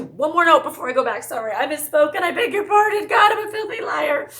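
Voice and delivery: high, loud hammed-up shout; over-the-top theatrical apology, very loud; mock outraged self-rebuke. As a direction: build to a shrill, shouted mock confession. Mock outburst over misspeaking.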